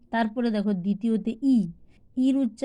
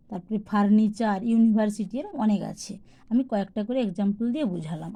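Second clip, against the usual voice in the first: ordinary talk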